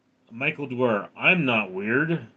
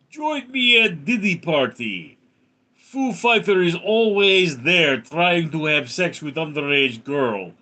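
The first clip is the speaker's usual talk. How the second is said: hammed-up Russian accent, pitched higher; 5 semitones higher, 4 dB louder than usual. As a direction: put on a thick mock Russian voice. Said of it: "in a Russian accent".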